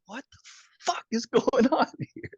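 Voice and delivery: high, climbing disbelief breaking into a laugh; disbelieving laugh. Retold or imitated. Reading the friend's warning, high laughing disbelief. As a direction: let it rise to a laughing squeak.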